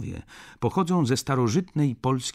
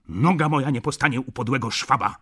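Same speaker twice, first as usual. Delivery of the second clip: louder, higher, quick angry retort; indignant shout; 5 semitones higher, 6 dB louder, faster than usual. A narrator's reading. Jan raging after his departing brother, indignant shout. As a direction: swear it in a raised, rushed voice. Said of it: "krzyknął Jan".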